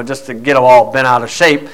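ordinary talk

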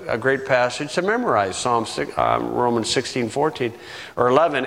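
ordinary talk

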